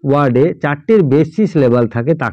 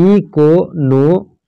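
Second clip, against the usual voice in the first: Economy very slowly, as ই, কো, নো, মি; syllable by syllable; 3 semitones higher, much slower than usual. Shown as a demonstration. Example with four syllables, economy split as ই-কো-নো-মি. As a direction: stretch and separate each syllable of economy. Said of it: "এখানে চারটে syllable".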